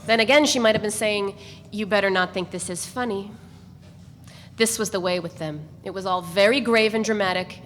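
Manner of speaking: usual speaking voice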